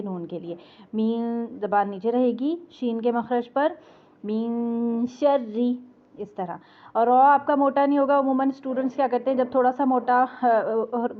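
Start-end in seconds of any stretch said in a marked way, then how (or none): 4.2-6.6 मीन शर्री with a nasal hidden n, tongue down; slowly hide the n in मीन शर्री; ikhfa demo. Shown as a demonstration.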